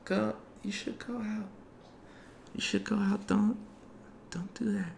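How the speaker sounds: put-on high, soft little girl voice; tiny voice urging quietly, ending whispered; pixie. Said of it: "you hear a little voice say".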